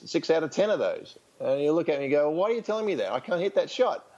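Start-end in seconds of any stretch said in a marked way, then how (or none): 2.3-4.1 higher, exasperated protest at the coach; a retold player's frustrated complaint; indignant player. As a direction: push back like a frustrated player.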